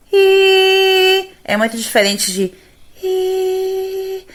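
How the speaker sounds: high, airy falsetto vowel; breathy falsetto. Shown as a demonstration. Teacher demos falsetto, high and airy. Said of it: "Isso aqui é um falsete".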